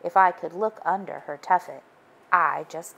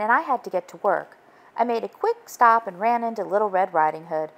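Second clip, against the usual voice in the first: usual speaking voice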